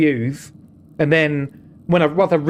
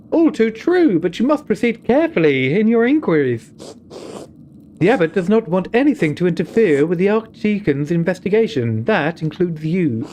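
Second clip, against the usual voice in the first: higher, measured voice of a wary nun; a nun cautioning the visitor, firm and grave; stern nun; 4 semitones higher than usual. Acted. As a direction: warn him like a careful abbey sister.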